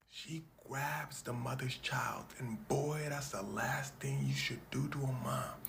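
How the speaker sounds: slow whispered buildup; hushed suspense. Acted. Comedian building suspense before the punch, whispering. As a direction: whisper the bad move.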